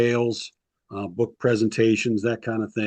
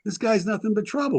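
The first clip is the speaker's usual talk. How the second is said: higher, fed-up complaint; exasperated complaint; 10 semitones higher than usual. Retold or imitated. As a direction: vent about the troublemaker. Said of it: "Perry Moss finally calls Jack Pardee and says".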